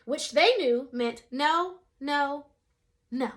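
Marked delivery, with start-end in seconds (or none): none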